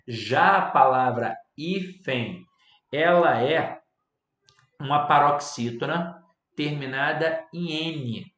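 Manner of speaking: slow, loud, spelling out the rule; emphatic, slow and loud. Shown as a demonstration. Teacher stressing the hífen trap to students, loud and slow. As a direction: hammer home that hífen ends in N. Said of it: "emphasis on hífen rule".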